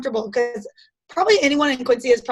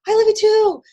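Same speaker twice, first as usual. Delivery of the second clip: high, louder retold shout; imitated call back; 9 semitones higher, 5 dB louder than usual. Shopper answers sister-in-law across the supermarket, high retold call. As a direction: call back high to someone aisles away.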